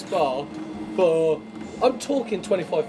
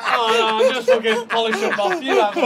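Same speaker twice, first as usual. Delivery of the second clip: very high, loud prissy voice; hammed-up overpolite friend; pretending a decent mate hands back the drinks, high and prim; mock polite offer; 9 semitones higher, 5 dB louder than usual. Mimicking polite friend refusing drinks.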